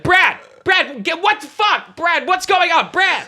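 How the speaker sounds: high, frantic calling out; mom's frantic yelling. Retold or imitated. Imitating mom who doesn't know what's going on, frantic yell. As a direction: yell for your husband in alarm. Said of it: "My mom doesn't know what's going on".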